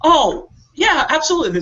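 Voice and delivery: high, eager yes; mock eager agreement. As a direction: sound overly accommodating. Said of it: "I'm like".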